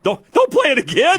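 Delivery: high-pitched, urgent objection; real alarmed outburst, pitch jumping up; urgent protest. Real, not acted. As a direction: plead loudly to stop it. Alarmed plea to stop replay.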